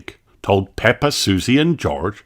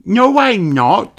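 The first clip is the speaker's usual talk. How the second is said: put-on high, slow child denial; little sheep denying it; 12 semitones higher, 4 dB louder, slower than usual. A narrator's reading. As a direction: protest like a little girl. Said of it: "Susie said".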